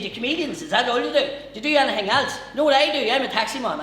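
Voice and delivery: put-on high, loud Newry accent; manic Newry man. Newry taxi man grilling a comedian, manic and loud. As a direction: badger them with questions, full volume. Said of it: "the maddest experience with somebody from Newry".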